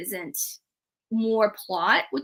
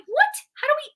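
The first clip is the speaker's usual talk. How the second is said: much higher, rushed disbelief; incredulous outburst; 7 semitones higher, faster than usual. Retold or imitated. Reader ranting to the character about the plot, high and incredulous. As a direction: pitch jumps up in exasperated disbelief, then drops to scold Kaz.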